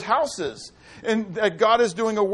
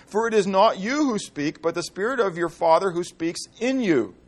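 scripture reading; 3 semitones lower than usual. Acted. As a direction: read the verses with weight. Preacher reads scripture aloud.

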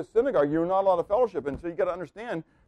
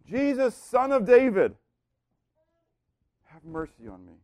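crying out for mercy. Retold or imitated. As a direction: call out to Jesus, then drop away. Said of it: "he cries out to Jesus, and he cries out for mercy".

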